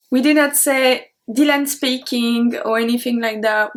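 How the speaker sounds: usual speaking voice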